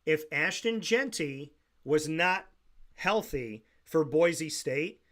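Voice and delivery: drawn-out, with a pause mid-sentence; deliberately slow. Real, not acted. Host explaining Boise State's case to doubting listeners, slowly. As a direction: set up the comparison slowly. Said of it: "I will say it slow".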